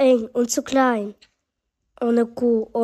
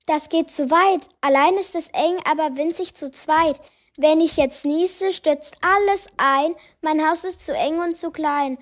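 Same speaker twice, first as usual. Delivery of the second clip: a bit higher granny voice, fretful; mildly raised old-lady lament; lightly voiced old woman; 5 semitones higher than usual. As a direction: play a fussy grandmother complaining. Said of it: "Oma Agathe rief".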